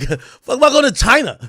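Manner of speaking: high, loud disbelieving outburst; laughing incredulous shout. Retold or imitated. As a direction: yell it in total disbelief. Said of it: "I'm like".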